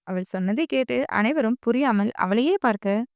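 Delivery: plain reading voice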